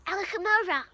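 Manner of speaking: plain reading voice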